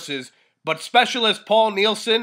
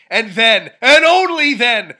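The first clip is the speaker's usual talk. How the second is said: building shout; 3 semitones higher, 6 dB louder than usual. Acted. Building climax of promo.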